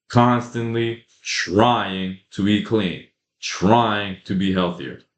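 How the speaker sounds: slow, with "trying" drawn out and weighted; stress on trying. Shown as a demonstration. Vlogger explains the word he underlined, slow stress on "trying". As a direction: lean on "trying" as the weak word. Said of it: "I underlined that word, trying".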